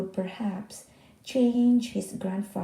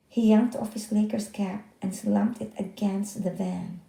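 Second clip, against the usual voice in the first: ordinary delivery, nothing marked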